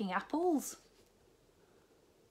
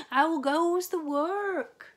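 high, loud, slow bellow from bed; lazy farmer calling; 9 semitones higher, 10 dB louder, much slower than usual. A narrator's reading. Lazy farmer calling from bed to the duck, high loud bellow. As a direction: boom it out like a lazy boss.

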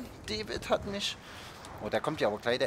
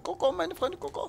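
much higher, sing-song voice for Coco; squeaky high Coco. Acted. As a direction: call Coco out in a silly falsetto. Sings out the name Coco. (hohe Quietschstimme)

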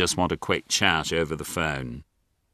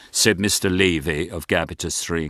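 plain reading voice

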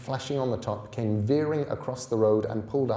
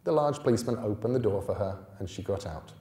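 ordinary delivery, nothing marked

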